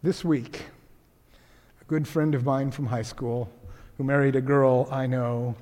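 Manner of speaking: very soft and low, with long pauses; grave, subdued. Real, not acted. Preacher telling of a friend's loss, grave and hushed. Turns to death of a child.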